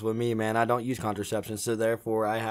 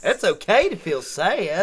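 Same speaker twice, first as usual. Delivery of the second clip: mock puppet voice; 8 semitones higher, 7 dB louder, slower than usual. Puppet voice joke.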